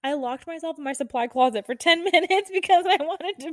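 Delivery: high, whining voice breaking into laughter; laughing meltdown. Acted. Nurse confessing she hid in supply closet, laughing meltdown.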